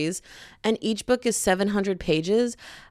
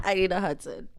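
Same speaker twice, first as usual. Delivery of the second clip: ordinary talk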